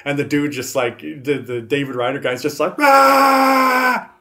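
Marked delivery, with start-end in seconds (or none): 2.8-4.1 long, loud high-pitched scream; hammed-up action-hero yell, held long; mock scream. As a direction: scream it like a B-movie hero charging. Imitates hero screaming in chicken run.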